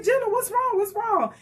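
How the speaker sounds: high, alarmed what's wrong; mother's high worried question to her daughter. Retold or imitated. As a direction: ask the child in sudden worry, pitched high. Worried mother asks child.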